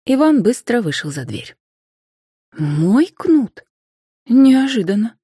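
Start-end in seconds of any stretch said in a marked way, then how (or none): 2.6-5.2 higher, louder wondering question to oneself; surprised. A narrator's reading. Anfisa talking to herself about her new whip, surprised. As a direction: think aloud in surprise. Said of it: "проговорила она вслух".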